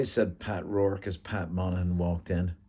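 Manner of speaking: usual speaking voice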